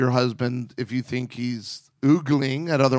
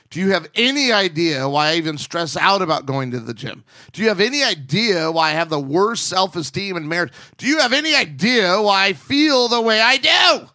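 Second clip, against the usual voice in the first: building louder and higher to an exasperated peak; escalating rhetorical questions, last one loud and high; emphasis added; 5 semitones higher, 5 dB louder than usual. Acted. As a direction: pile on the emphasis until it bursts. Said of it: "Emphasis added".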